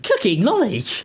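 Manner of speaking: high-pitched disbelieving repeat; incredulous echo. Retold or imitated. YouTuber mocking a claim of cooking skill, incredulous.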